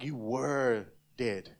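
"were" stretched slowly, then "dead"; stress on were. Shown as a demonstration. Preacher stressing the past tense, slow emphasis on "were". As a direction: draw out "were" with weight. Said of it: "everybody say "were".

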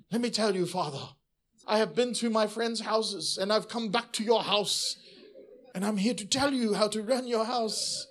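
mildly voiced cocky son lecturing his father; a light mock of a know-it-all child; cheeky son. Pastor imitating himself lecturing his dad, mock cocky. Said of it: "slap that accent out of my mouth".

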